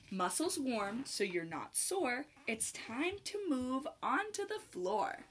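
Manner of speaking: lively, sing-song picture-book read; bouncy rhyming storybook voice; reading to children. Acted. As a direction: read it like storytime for little dancers. Reads picture book to kids.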